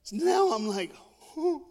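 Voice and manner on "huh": high, short startled "huh"; mock-frightened little intake; scared gasp. Boy finds the light unplugged, high scared gasp. Frightened boy's reaction in the dark.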